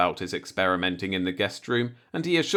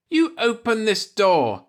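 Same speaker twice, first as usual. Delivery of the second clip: much higher, louder, slow stern command; ordering; 11 semitones higher, 4 dB louder than usual. Acted. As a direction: lay down the law, word by word. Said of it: "she ordered".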